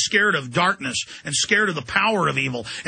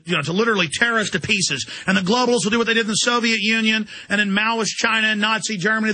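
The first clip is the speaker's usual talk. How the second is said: normal talking voice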